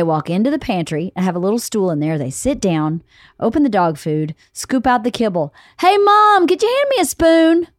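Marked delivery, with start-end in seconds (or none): 5.8-7.7 put-on high whiny kid call, louder. Voicing kid calling mom.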